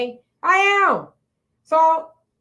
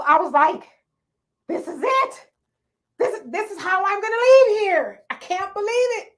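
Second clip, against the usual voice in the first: retold panicked prayer; 7 semitones higher, 4 dB louder than usual. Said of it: "And I'm just like".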